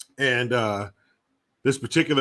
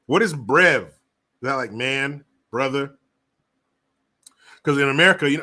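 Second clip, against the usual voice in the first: ordinary talk, nothing marked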